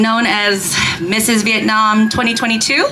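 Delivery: usual speaking voice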